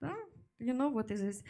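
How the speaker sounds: ordinary delivery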